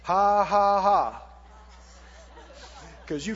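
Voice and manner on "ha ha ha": forced, low "ha, ha, ha"; deliberately fake, joyless laugh; put-on laugh. Shown as a demonstration. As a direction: laugh dutifully when you feel like crying. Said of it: "you have to put it on".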